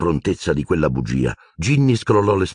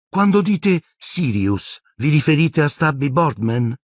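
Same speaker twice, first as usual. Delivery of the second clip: put-on slow, much higher airy girl voice; dreamy Luna; 9 semitones higher than usual. A narrator's reading. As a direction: drift through it, far away. Luna's dreamy voice.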